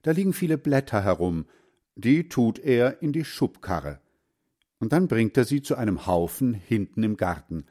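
ordinary delivery, nothing marked

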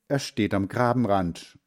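plain reading voice

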